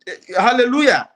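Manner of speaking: loud, high burst of hallelujah; excited hallelujah. Real, not acted. Guest cheering his own point, loud high hallelujah. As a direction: cry it out in triumph. Exclaiming during heated interview answer.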